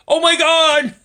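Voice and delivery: sudden high, loud cry of disbelief; exasperated outburst. Real, not acted. Vlogger fed up with social media posts, exasperated high loud cry. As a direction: throw it up in exasperation.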